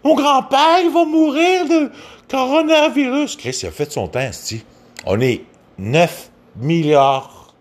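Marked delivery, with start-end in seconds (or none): 0.0-3.4 high squeaky falsetto panic; mock them in a shrill high voice; mocking hysterical woman. Mocking women fretting about a grandfather, high falsetto. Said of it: "t'as des hosties de folles qui disent".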